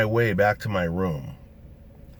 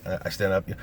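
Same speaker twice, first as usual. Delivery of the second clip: ordinary talk, nothing marked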